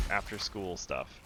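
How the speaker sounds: usual speaking voice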